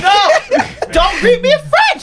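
laughing protest. Real, not acted. Laughing protest about being greeted in French. Joking rant at Montreal greetings.

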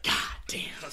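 voiceless, forceful exclamation; strained whispered curse. Retold or imitated. Young pitcher with his arm on fire, whispered curse. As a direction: curse under strain with the voice dropped out.

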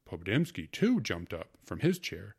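usual speaking voice

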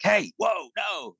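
sudden high warning shout; retold yell. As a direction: snap out a quick warning to a child. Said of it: "just kinda started yelling".

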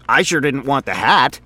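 a rambling, self-amused office guy; chatty coworker voice, in a different timbre from the narrator; Frink, chatty coworker. Acted. Frink voice again.

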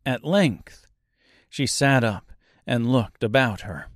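ordinary delivery, nothing marked

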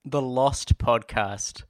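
slow dramatic title read; slow announcement. Acted. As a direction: draw out the title.

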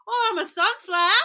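high, loud Aussie exclamation; Australian accent. Retold or imitated. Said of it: "about an Australian accent".

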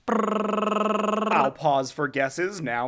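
drawn-out, teasing host; slow game show tease. Acted. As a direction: stretch it out like a quiz host.

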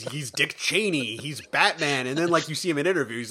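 ordinary talk, nothing marked

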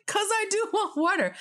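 high-pitched mock insistence; retold protest. Retold exasperated answer to friend.

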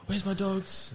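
put-on high-pitched kid asking; worried kid. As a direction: play the boy looking for his dogs. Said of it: "he's like".

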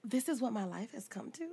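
low, hushed disbelief; inner thought. Retold or imitated. Woman reliving her thought on one knee, quietly incredulous. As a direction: drop soft, as if thinking to yourself. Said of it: "I was thinking".

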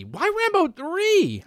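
playful disbelief at the pick; drawn-out disbelief. Real, not acted. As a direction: stretch it out in disbelief. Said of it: "That's your criteria".

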